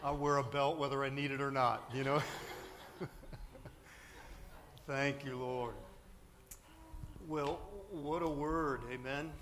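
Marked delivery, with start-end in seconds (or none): 2.2-4.8 soft airy laugh with no voice; breathy silent laugh. Real, not acted. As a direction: wheeze out a laugh. Laughing after belt joke.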